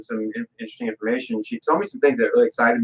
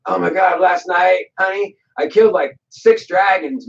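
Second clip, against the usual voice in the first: boastful excitement; 6 semitones higher than usual. Retold or imitated. Drunk Hercules boasting to wife.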